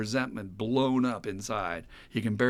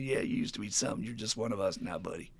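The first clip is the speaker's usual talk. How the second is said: quiet mocking taunt; 3 semitones lower, 7 dB quieter, faster than usual. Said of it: "they're laughing at his ass".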